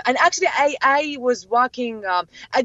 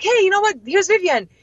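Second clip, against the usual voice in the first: high, eager retold announcement; excited introduction; 9 semitones higher, 4 dB louder than usual. Friend presenting Vivian to a woman, high eager voice.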